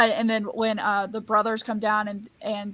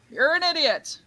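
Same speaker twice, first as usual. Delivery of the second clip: echo; 7 semitones higher than usual. Acted. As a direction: bounce it back high, without shouting. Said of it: "Repeats that".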